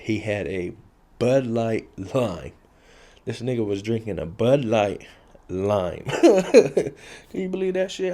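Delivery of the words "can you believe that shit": laughing disbelief. Real, not acted. Laughing at the absurd detail.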